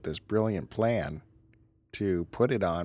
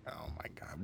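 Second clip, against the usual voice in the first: stunned whisper; 7 semitones lower, 17 dB quieter than usual. Real, not acted. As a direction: breathe it in disbelief. Disbelief at friend's taste.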